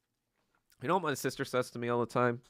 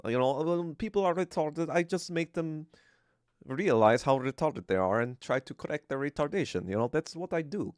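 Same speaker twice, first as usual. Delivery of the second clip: put-on made-up Russian accent, blunt and unapologetic; mock Russian Stalin; 3 semitones higher than usual. Stalin brushing off his critics, in a mock Russian accent. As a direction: play a rude dictator with a hammy Russian accent. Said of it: "that's how I imagine Stalin".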